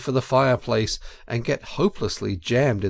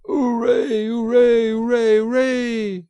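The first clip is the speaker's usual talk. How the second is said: high, loud crowd of cheering weasels; 11 semitones higher, 6 dB louder than usual. A narrator's reading. Weasels cheering overhead at the banquet, high and loud. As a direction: shout it up like a rowdy party.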